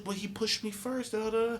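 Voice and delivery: high, soft mock kid voice; imitating his younger self, pitched high; kid protesting. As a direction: plead your case like a fourth grader. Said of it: "I was like".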